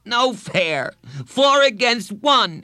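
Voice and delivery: high, loud schoolboy protest; aggrieved kid's yell; shout. A narrator's reading. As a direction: shout it out, stretching the last words. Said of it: "he shouted".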